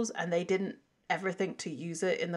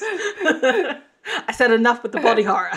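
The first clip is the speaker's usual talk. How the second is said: louder, higher, laughing through it; real laugh while protesting, higher and louder; 6 semitones higher, 9 dB louder than usual. Real, not acted.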